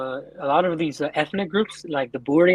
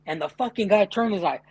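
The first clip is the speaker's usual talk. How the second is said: ordinary delivery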